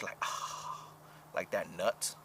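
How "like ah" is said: breathy sigh. Acted. Describing sexual climax.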